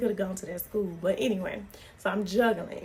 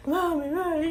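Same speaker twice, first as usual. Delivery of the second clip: mock child yelling; 8 semitones higher than usual. Son wailing for his mommy in the background, mock child yell. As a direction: call for mommy like a hurting kid. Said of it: "He's just yelling".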